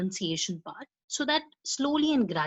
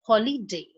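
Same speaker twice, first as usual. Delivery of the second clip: holiday with the first syllable HOL stressed; 3 dB louder than usual. Shown as a demonstration. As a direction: lean on HOL in holiday, rest light. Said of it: "say after me".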